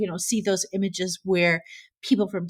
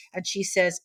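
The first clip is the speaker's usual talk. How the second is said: ordinary talk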